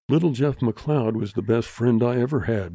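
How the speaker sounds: ordinary delivery, nothing marked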